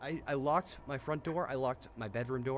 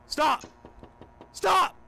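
high, loud begging yell; panicked shout; 7 dB louder than usual. Real, not acted. Player begging the creature to stop, screaming. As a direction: shout them away in terror. Something approaching in game.

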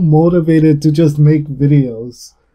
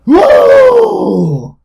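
a high, loud victory whoop; excited whoop; 5 dB louder than usual. Real, not acted. Gamer cheering as Gryffindor wins, loud whoop. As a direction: let out a joyful cheer.

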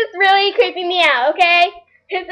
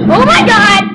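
startled; 7 dB louder than usual. Real, not acted. Startled by a noise.

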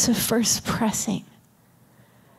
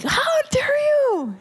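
high, slow mock outrage; hammed-up indignation at God; David shouting at God; indignant outburst; 14 semitones higher, slower than usual. David letting God have it.

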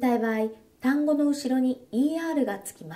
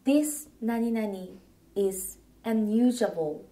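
slow, careful English for learners; slow clear model phrase; slower than usual. Shown as a demonstration. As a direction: say it slowly for the class. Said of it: "この何々は使えません。". (ゆっくり丁寧な見本の発音)

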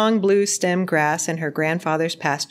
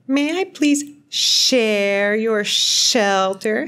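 slow, drawn-out hiss, higher pitched; hissing snake; 4 semitones higher, much slower than usual. A narrator's reading. A voice in the dark asks to share shelter, hissing. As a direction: stretch the s and sh sounds like a snake. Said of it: "hissed a voice in the dark".

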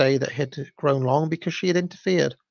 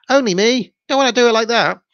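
high, loud comic busybody impression; Only Me; 9 semitones higher, 4 dB louder, faster than usual. Narrator mimicking a comedy character for listeners, high interfering busybody. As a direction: butt in loudly, pitched right up. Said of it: "reminds me a little bit of the Harry Enfield character".

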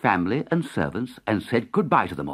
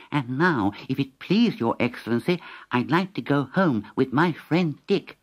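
cat voice; 6 semitones higher than usual. Acted. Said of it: "said Tom".

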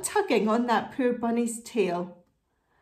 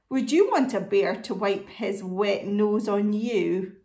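ordinary delivery

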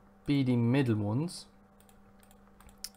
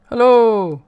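loud, high hello; called-out greeting; 10 semitones higher, 14 dB louder than usual. Real, not acted. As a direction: call out hello to a newcomer. Streamer calls out greeting to viewer.

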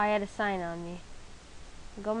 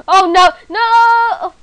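loud high panicked no; alarmed cry; 10 semitones higher, 20 dB louder than usual. Real, not acted. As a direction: yelp in sudden alarm. Cactus hurts while eating.

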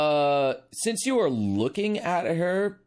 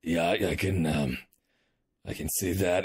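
gruff commander; 9 semitones lower than usual. Acted. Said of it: "he's just like".

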